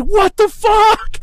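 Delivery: high, slow drawn-out shock; stunned disbelief. Retold or imitated. Guy just hit on the back, high slow stunned disbelief. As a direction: stretch it out in total disbelief.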